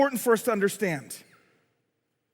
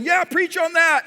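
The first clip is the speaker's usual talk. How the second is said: mild cheer from the pews, pitched up; eager congregant; 8 semitones higher, 3 dB louder than usual. Acted. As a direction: egg the preacher on, higher and stretched. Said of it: "some of you in here probably going".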